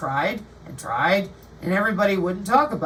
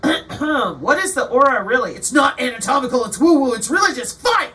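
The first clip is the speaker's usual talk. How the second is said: mock heated rant; 4 semitones higher, 6 dB louder than usual. Mock heated reply to question.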